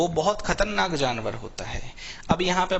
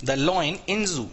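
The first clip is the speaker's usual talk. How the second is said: same slow drawn-out reading again; slow repeat; much slower than usual. Shown as a demonstration. Teacher repeating the phrase for the students. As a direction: repeat it just as slowly. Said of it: "ऐसे बोल दो".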